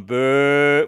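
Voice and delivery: drawn-out mock jeer; imitated crowd booing. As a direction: boo like a hostile audience. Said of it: "it was just them going".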